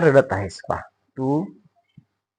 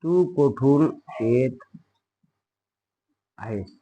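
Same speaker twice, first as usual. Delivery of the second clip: slow, softer, separated words; slow dictation; 5 dB quieter, slower than usual. Shown as a demonstration. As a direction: leave gaps for pupils to write. Teacher repeats slowly for dictation. (सावकाश, थांबत, हळू आवाजात)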